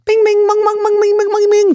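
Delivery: high, loud sung bings and bongs; pinball sound imitation. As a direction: mimic pinball machine chimes. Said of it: "It goes like this".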